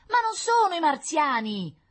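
put-on very high, loud, slow child protest; tearful little girl. A narrator's reading. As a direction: protest like an upset little daughter. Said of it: "asciugandosi le lacrime". (protesta acuta da bambina in lacrime)

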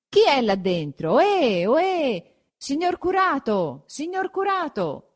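high, drawn-out hailing call. A narrator's reading. Villagers calling up to the curate, high drawn-out calls. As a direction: call up to a closed window, voice climbing high. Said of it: "quando si sentì chiamare a voce di popolo".

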